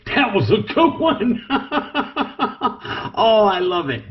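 high, delighted laughing exclamations after the strike; real giddy laughter, pitch jumping way up; thrilled laughing whoop. Real, not acted. Keeper watching his gaboon strike, whooping with laughter.

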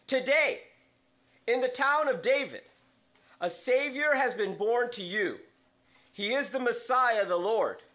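reading scripture aloud from the pulpit. Acted. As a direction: proclaim the angel's news to the congregation. Reads the Luke nativity passage.

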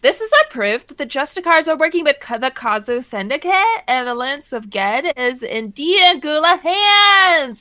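fast, excited villain-hunter announcement; NPC quest giver. Acted. Game character announcing proof to the party, excited. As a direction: proclaim the discovery triumphantly.

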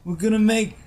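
high, clueless mock politician; Trump. Playing the highwayman foe, mock Trump voice. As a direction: fumble along in a hammed-up presidential voice. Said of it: "try your Trump impression".

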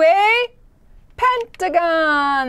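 slow, high drawn-out reveal; dramatic reveal. Acted. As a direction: stretch it out like a big reveal. Dramatic reveal of new shape.

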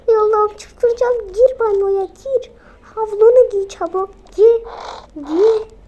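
mother shouting at daughter; frantic orders. Acted. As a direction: hurry her into the bathroom, panicked. Mother ordering child to bathroom.

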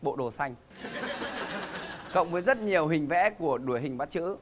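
booming announcer introducing contestants; game show host. Acted. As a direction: present them to the studio audience.

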